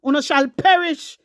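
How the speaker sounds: usual speaking voice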